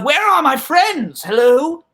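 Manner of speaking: high, searching calls to missing friends; calling out. Acted. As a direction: call into an empty building, drawing out names. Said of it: "Hadas calls out".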